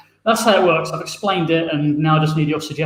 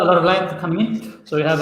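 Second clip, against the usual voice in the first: normal talking voice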